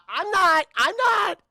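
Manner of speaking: high, loud, panicked stammering. Retold or imitated. Cruise refusing the general's order, panicked stammer. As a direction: blurt it out in a hammed-up panic.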